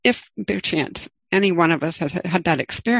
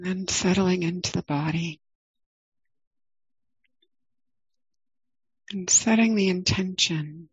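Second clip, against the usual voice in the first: guided meditation. Acted. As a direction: ease listeners into the body, gently.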